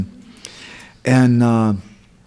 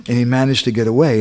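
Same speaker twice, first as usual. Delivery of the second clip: usual speaking voice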